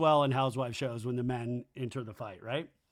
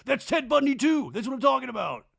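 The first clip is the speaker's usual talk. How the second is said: high, loud call from the sidelines; mock heckling; 9 semitones higher, 6 dB louder than usual. [name] chiming in from the corner, loud high heckle. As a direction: crow it out excitedly.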